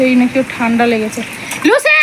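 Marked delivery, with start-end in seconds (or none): 1.6-1.9 calling out. Real, not acted. Calling the dog by name.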